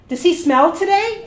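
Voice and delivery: imitating a loud, high, tactless question; loud repeated question. Doctor asks again beside patient, loud and tactless.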